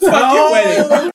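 yelled high through laughter; laughing shout. Real, not acted. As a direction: shout it, cracking up. Laughing outrage at fajita wedding.

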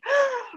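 sharp high gasp of shock; excited gasp. Retold or imitated. Bursting into the boss's office, a high excited gasp. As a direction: gasp like you can't believe the news.

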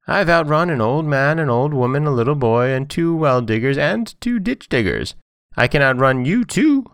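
high, bragging little character voice; boastful Johnny Cake. A narrator's reading. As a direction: rattle off the list as a cheeky boast. Johnny Cake brags to the bear.

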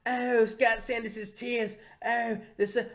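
high, slow, savouring cartoon voice; Cartman. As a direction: relish it with drawn-out ghoulish delight. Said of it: "and he's like".

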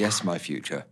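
whispered reply; whispering. Acted. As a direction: whisper back, playing along. Whispering back to mystic.

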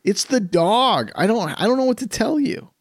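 high, half-mock protest of innocence; husband replaying how he denied farting; defensive husband. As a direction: plead innocent, blame the dog. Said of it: "I'm like".

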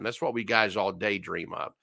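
normal talking voice

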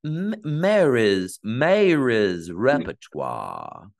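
slow, stretched South Carolina drawl; Southern family accent. Retold or imitated. Imitating her family praising Mary, in a slow Southern drawl. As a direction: drag out every vowel in a Southern accent. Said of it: "That was in your family".

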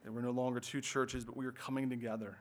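soft, hushed prayer voice; praying quietly to the Lord. Acted. Pastor praying to open the sermon, soft and reverent.

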